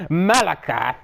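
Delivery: plain reading voice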